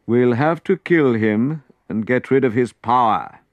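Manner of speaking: loud, ruthless decree; high priest declaring. A narrator's reading. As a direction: lay down the verdict. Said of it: "declared the high priest".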